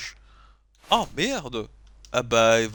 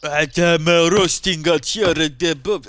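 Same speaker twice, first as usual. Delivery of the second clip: slow English in a put-on Russian accent; 4 dB louder, slower than usual. Player echoing the game character's complaint, Russian accent. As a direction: mimic the line with a heavy Russian accent, slowly. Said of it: "avec l'accent russe quand même".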